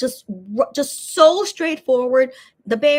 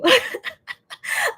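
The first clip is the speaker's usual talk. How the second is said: a burst of real high laughter; 12 semitones higher than usual. Real, not acted. Laughing at the doll video.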